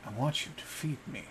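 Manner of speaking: soft, quick and menacing; lightly voiced monster child, softly sinister; sinister smiling demand. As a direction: purr the order like a predator. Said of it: "he smiles at him and he goes".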